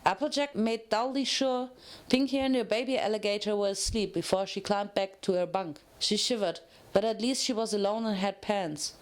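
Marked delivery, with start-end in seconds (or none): none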